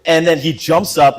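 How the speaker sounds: ordinary talk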